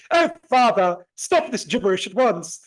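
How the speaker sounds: loud, high mock-posh little boy; posh accent. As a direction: haughtily rebuke father in an exaggerated posh accent. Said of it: "I have this weird posh accent".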